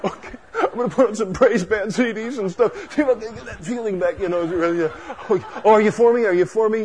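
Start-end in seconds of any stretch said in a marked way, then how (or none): 0.0-4.2 higher, hurried send-up with a laugh; mock eagerness. Backslider scrambling for praise music, mock eagerness. 5.3-6.8 higher, laughing anxious question; mock pleading. Backslider asking whether God is for him, mock pleading. Mocking a backslider's hurried prayer.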